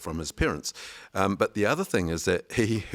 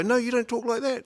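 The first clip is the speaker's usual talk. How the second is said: high-pitched mock protest; insistent, higher imitated voice; insistent retort. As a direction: imitate someone objecting, voice pitched up. Voicing street people's objection.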